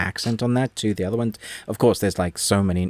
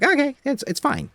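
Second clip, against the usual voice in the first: a sudden jump much higher on okay, then settling down; mock okay fine. Viewer voicing the characters with a squeaky mock okay. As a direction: toss it off with a squeaky mock shrug. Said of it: "and they're like".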